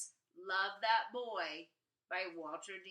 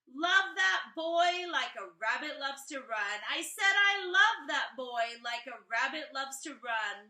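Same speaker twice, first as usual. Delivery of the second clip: louder, rhythmic reading of the original poem; 4 semitones higher, 7 dB louder than usual. A narrator's reading. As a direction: give the lines a steady bouncing beat. Reads original poem aloud.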